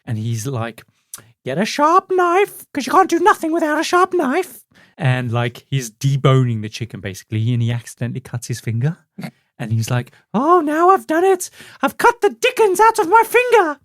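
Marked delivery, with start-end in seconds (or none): 1.4-4.6 very high, put-on falsetto; hammed-up TV cook in a piping voice; Julia Child parody. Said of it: "Dan Aykroyd pretending basically to be Julia Childs". 10.3-13.8 very high, louder mock dismay; Julia Child parody. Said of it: "pretending basically to be Julia Childs".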